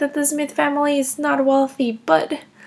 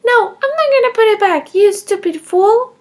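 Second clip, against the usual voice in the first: higher, louder, last words slowed; rude child outburst; 5 semitones higher, 6 dB louder than usual. Acted. Emma defiantly insulting Bridget, louder and higher. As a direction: spit the insult out like a bratty kid.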